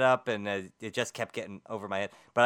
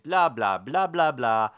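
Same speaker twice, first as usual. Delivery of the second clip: dismissive blah blah; 3 semitones higher, 7 dB louder, slower than usual. Real, not acted. Dismissing the topic.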